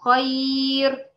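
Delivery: خير drawn out very slowly with a thin ra; recited example word. Shown as a demonstration. Teacher slowly reciting خير for students. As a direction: stretch خير like Quran recitation. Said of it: "أمثلة زي كلمة".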